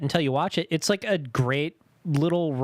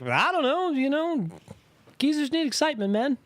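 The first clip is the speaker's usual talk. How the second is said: high mock voice; 9 semitones higher than usual. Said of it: "I was just like".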